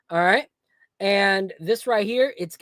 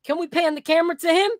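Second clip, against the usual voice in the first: put-on high, fast, cheeky child voice; kid voice; 9 semitones higher than usual. Retelling the child comedian calling out his dad, high cheeky voice. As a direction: point out dad like a mischievous kid. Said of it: "And he said".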